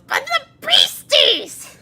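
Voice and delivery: put-on very high, loud, drawn-out child protest; Halamario. Child objects to escorting the guests upstairs, loud high wail. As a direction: shriek the protest slowly like a thwarted child. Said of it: "the Halamario goes".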